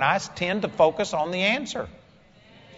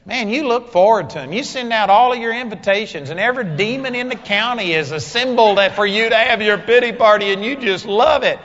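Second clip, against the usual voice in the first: loud, raised sermon voice; forceful preaching; 6 semitones higher, 6 dB louder than usual. Real, not acted. Preacher scolds congregation about pity parties, forceful preaching. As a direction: drive the rebuke home loudly.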